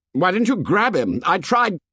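high-pitched heated exchange; high, defensive outburst; voice running up. A narrator's reading. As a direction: push the voice up in protest. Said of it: "Ralph's voice ran up".

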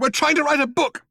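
high, urgent yell; yelled protest. A narrator's reading. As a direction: plead at a yell. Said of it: "yelled Ford".

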